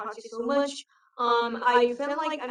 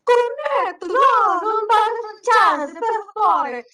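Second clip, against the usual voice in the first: much higher, louder Italian shout; shouting Italian barista; 9 semitones higher, 8 dB louder than usual. Retold or imitated. Canteen guy shouting at a foreign student, loud and high. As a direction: bark the order back across the counter. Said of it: "shouting out to you".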